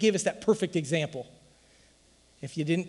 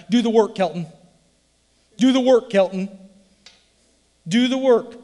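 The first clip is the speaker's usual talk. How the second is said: louder, insistent push; preacher pushing Kelton to get working; coaching command; 5 semitones higher, 7 dB louder than usual. Retold or imitated. Insistent louder command. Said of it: "heard me say that a hundred times".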